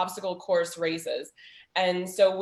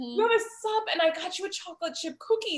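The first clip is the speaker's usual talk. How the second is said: high-pitched, worked-up mom retort; frustrated; 11 semitones higher than usual. Retold or imitated. Mother to daughter sulking about bacon, frustrated. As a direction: argue back, exasperated and pitched way up. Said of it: "I'm frustrated because you're mad".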